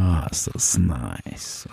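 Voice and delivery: low, slow, dreamy relief; blissed-out sigh. Pretend. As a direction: sink into mock bliss. Relaxing with Zen garden gong.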